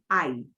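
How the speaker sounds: "I" as one glide, neither vowel punched; "I" said as one syllable, like eye; blended diphthong. Shown as a demonstration. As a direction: blend A and E evenly into "I". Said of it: "the A more than the E".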